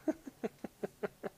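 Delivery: high, soft chuckle; light self-amused laugh. Real, not acted. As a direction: chuckle to yourself at your own silliness. Laughs at his accent boast.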